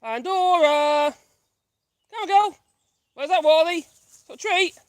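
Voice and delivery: high, loud call across the distance; calling a dog. Real, not acted. Calling Pandora back with a treat, calling out.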